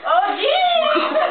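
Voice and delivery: high yelp through laughter; laughing squeal. Real, not acted. Tangled up with her friend, laughing yelp. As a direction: squeal it out mid-giggle.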